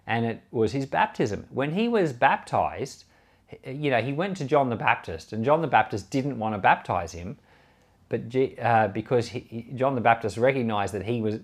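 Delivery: ordinary delivery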